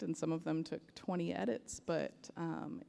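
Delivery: normal talking voice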